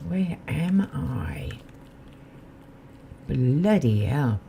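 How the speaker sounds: ordinary talk, nothing marked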